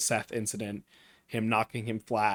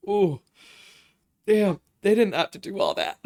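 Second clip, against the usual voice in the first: emotional outburst; 6 semitones higher, 5 dB louder than usual. Real, not acted. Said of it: "wasn't gonna cry".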